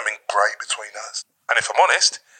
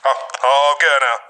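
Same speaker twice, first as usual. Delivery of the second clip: ordinary talk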